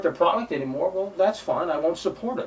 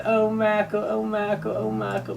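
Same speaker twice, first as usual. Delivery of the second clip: mocking whiny fans; 5 semitones higher than usual. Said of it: "fake Resident Evil fans going".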